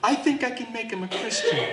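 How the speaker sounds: high-pitched, naively hopeful mock plea; lovesick pleading. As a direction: make a besotted excuse, hammed up. Mimics lovesick believer making excuses.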